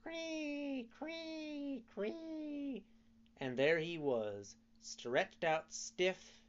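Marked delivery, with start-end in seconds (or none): 0.0-2.8 high, thin dying cricket chirps; a last faint cricket cry. A narrator's reading. Cricket hit by the mallet, high fading chirps. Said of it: "scarcely time to cry".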